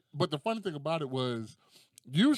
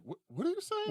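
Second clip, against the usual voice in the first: high-pitched, startled question; mock shocked mom. Mom hears child say booty butt cheeks, mock shock. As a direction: question it in comic alarm.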